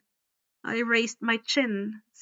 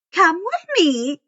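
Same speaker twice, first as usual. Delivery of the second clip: stern teacher; 7 semitones higher, 6 dB louder than usual. A narrator's reading. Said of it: "she said".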